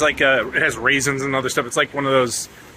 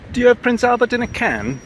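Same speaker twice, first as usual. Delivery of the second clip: much higher, louder put-on voice for the old gag; mock prank caller; 12 semitones higher, 5 dB louder than usual. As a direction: ham up the tired joke in a silly voice. Old prank-call joke, put-on voice.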